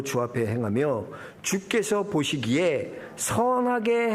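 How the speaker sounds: scripture reading. Acted. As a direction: read the verses with reverence. Pastor reads scripture aloud.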